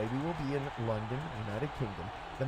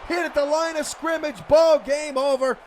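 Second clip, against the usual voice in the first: much higher and louder, shouting the final stop; game-ending shout; 10 semitones higher, 12 dB louder than usual. Real, not acted. Announcer shouts the game-clinching stop to listeners. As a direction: roar the call that ends the game.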